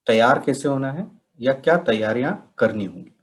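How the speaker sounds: ordinary talk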